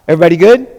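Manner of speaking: ordinary talk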